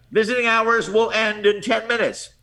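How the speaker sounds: hammed-up Brooklyn announcer; Brooklyn accent. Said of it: "Worst Brooklyn accent you can think of".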